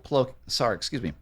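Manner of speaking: usual speaking voice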